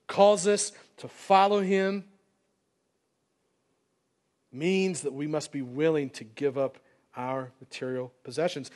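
ordinary delivery

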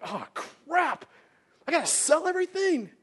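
high-pitched mock dismay; hammed-up upset, pitched high; upset rich man. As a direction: whine as a man acting out his upset. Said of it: "the dude left really upset".

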